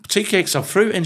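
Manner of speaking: high, pressing mock argument; high-pitched retold insistence; insistent retort. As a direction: insist like you're obviously right. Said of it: "I said".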